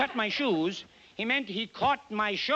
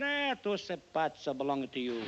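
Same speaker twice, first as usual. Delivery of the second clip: put-on Italian barber accent; 5 semitones lower, 5 dB quieter than usual. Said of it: "He said".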